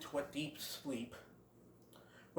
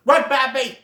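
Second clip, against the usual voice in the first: higher, louder urgent shout; 8 semitones higher, 9 dB louder than usual. Retold or imitated. Great Prince warning Bambi, urgent shout. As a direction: yell at him to flee. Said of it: "he shouts".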